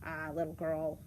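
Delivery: low, sly wolf; low storybook wolf voice; wolf posing as a neighbor. Acted. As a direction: play the wolf passing as a friendly neighbor, low.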